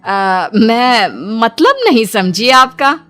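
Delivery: slow, hesitant confusion with swooping pitch; falter, not understanding; puzzled. Acted. Villager baffled by the newcomer's answer, puzzled.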